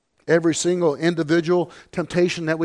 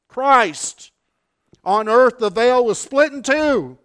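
louder, higher, ringing pulpit proclamation; preaching at full force; 6 semitones higher, 5 dB louder than usual. Acted.

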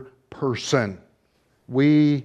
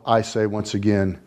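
ordinary talk

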